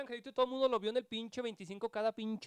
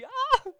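high, loud comic scream; imitated scream; 16 dB louder than usual. Showing his friend the film scream, high mock shriek. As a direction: shriek "ah" like the movie kid. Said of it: "y gritaba".

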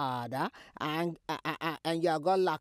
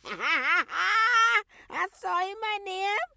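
crying laugh; 11 semitones higher, 6 dB louder, much slower than usual. Acted. Mother laughing in disbelief.

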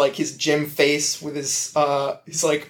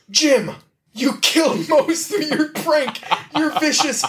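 high, laughing accusation; mock outrage; 10 semitones higher than usual. Dwight accusing Jim of the prank, high and laughing. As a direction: accuse him in a squeaky huff.

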